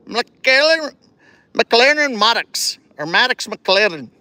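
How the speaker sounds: playful Scotch-Irish accent on the names; put-on Scotch-Irish accent. Shown as a demonstration. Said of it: "Did I say it complete with the accent".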